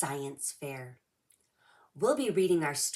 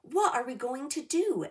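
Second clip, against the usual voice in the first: worried; 9 semitones higher, 5 dB louder than usual. Acted. Callie panics about blue paint.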